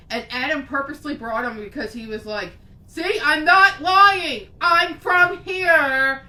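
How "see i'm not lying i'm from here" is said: loud, slow and pleading; slow, loud, desperate insistence; insistent plea. Retold or imitated. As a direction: drag each word out to prove you're not lying. Adam insists to his roommate.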